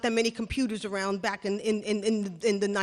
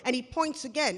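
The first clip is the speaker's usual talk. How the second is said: usual speaking voice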